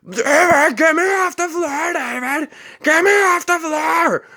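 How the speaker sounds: put-on high, loud desperate old man's shriek; mock helpless old man screaming high. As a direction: wail for David from the floor. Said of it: "The old man was yelling from the bathroom".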